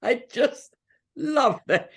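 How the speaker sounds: high, amused and fond; delighted. Real, not acted. Laughing delight at story.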